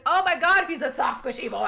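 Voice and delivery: high, loud oh my God gush; mock-gushing fan squeal; gushing delight.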